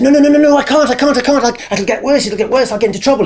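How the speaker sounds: high, loud, frantic refusal; panicked pleading. Retold or imitated. Boy begging his best friend not to tell, panicked. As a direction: beg him not to make you tell, voice racing up.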